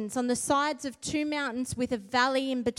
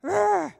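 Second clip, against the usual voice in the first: loud fierce growl; 6 semitones higher, 6 dB louder than usual. Shown as a demonstration. Preacher growling at the enemy, loud and fierce. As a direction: growl like a fighter ready to charge. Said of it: "We need to be a bit more like".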